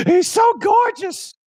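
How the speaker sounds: high, breathless gasp of delight; awed gush. Real, not acted. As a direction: swoon at the screen. Reacting to Gambit reveal.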